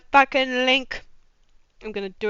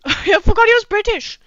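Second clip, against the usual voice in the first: ordinary delivery, nothing marked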